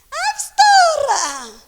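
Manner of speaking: high, loud, drawn-out "Ah, pastora!"; hammed-up gushing church lady; mock loud greeting. Pastor mimics a churchgoer gushing at her, high and loud. Mocking woman greeting loudly.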